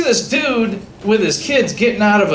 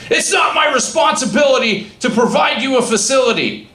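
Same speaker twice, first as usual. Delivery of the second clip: mock officious shout; 7 semitones higher than usual. Inspector retorts in argument.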